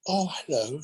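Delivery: lightly posh English lady greeting; a hint of a refined English accent, imitating Judi Dench; cultured English. Judi Dench greeting him in cultured English. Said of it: "she spoke with a very cultured English".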